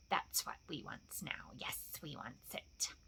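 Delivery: slow, very soft hissing whisper; covetous sibilant whisper; creature whisper. A narrator's reading. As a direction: whisper greedily to yourself, drawing out the hiss. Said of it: "he whispered to himself".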